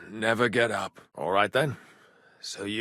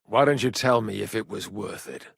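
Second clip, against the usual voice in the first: usual speaking voice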